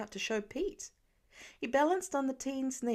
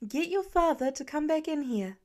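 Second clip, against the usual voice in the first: raised voice calling to the car; mother calling her daughter to fetch dad; 6 semitones higher, 4 dB louder than usual. A narrator's reading. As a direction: call out to the kid in the car.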